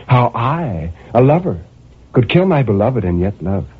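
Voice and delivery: ordinary delivery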